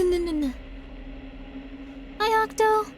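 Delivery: normal talking voice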